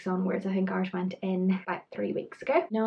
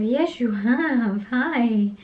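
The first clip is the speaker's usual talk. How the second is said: talking to the bump; 4 semitones higher, 6 dB louder, much slower than usual. Acted.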